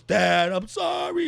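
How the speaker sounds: high, slow wailing apology; imitated crying plea. Father imitating his son apologising, mock wailing. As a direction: beg like a caught kid.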